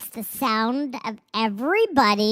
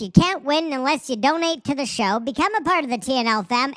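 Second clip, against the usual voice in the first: ordinary delivery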